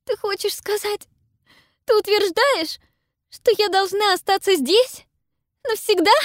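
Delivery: high, loud, horrified disbelief. A narrator's reading. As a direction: ask it in rising horror. Pippa in horror.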